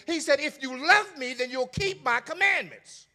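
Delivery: high, loud preacher's cry; preacher pressing Jesus's words, loud and high; preaching at full force. Acted. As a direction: hammer it home to the church.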